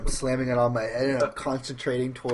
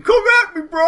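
high, loud challenge; screaming taunt; 13 dB louder than usual. Acted. Barsher screams a taunt at the Lictor. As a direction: scream it to bait the monster. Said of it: "I'm screaming".

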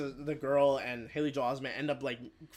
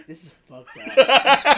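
much higher, much louder slow mock outrage; imitating Joel; 9 semitones higher, 16 dB louder, slower than usual. Retelling, he imitates Joel reacting to the movie. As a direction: stretch it out like a hammed-up Joel. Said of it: "Joel's like".

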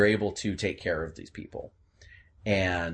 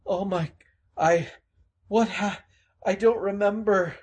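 higher, shaken confused voice; dazed Gerald; 8 semitones higher than usual. Acted. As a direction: stammer out the memory, frightened. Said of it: "Gerald is just gasping".

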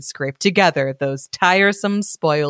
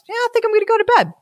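put-on silly girl voice; much faster than usual. Ayissa's sleepy thought voiced.

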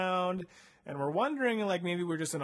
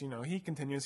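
ordinary talk

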